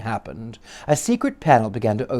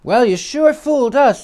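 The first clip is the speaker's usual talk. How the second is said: put-on boy voice; 10 semitones higher, 5 dB louder than usual.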